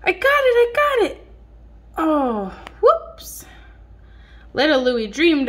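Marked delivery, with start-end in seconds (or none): 0.0-1.3 high, louder, eager cry; little dog lunging for his ball; excited dog. Acted. Dog chasing ball, acted. 2.0-3.5 dog losing his ball; surprised whoops. Acted. Dog slips.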